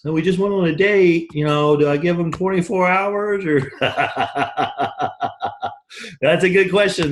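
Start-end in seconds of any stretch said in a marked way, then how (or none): 0.0-3.6 higher, louder mock anxious dating question; mock nervous dater. Coach mocking a nervous dater, higher and anxious. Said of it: "That sounds like dating advice". 3.6-5.7 a short real laugh at his own joke; amused laugh. Real, not acted. Coach laughing at his dating joke, real chuckle.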